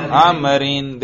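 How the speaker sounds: "عمرین" pronounced with an -in case ending; genitive ending. Shown as a demonstration. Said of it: "اس کے جر پہ تلفظ کرو".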